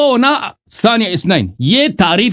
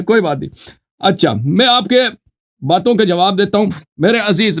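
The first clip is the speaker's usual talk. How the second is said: ordinary delivery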